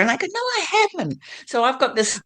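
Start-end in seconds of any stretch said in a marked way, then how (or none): 0.3-1.2 a much higher, softer, playful protest; mock denial. Retelling her denial to an Australian friend, a playful high protest. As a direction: squeak out an innocent denial. Retold denial of having an accent.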